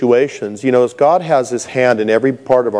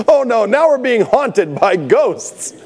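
mock dismay; 10 semitones higher than usual. Voicing bickering frightened disciples.

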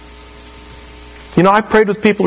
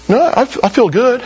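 higher, breezy mock reply; cheerful mock convert; 8 semitones higher than usual. The new convert tells the preacher, breezy and light. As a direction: toss it off lightly.

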